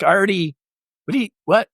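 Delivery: baffled you'd even suggest it; incredulous sputter. Retold or imitated. Retelling his reaction to fellow pilots, a sputtering, high disbelief. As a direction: pitch up sharply, tripping over the words.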